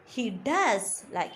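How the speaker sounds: does drawn out slowly; slow down and lean on does; stress on does. Shown as a demonstration. Teacher modelling emphasis, slow stress on does. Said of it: "We are stressing there".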